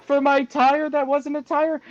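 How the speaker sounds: high, pleading little orphan voice; begging orphan. Retold or imitated. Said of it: "like Oliver Twist and sing for my supper".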